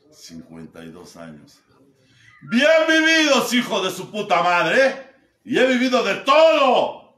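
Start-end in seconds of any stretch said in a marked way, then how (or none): 2.5-5.1 very high, loud and slow shout; proud shout. Real, not acted. Celebra sus 52 años. 5.4-7.1 very high, loud and slow shout; proud shout. Real, not acted. Celebra sus 52 años.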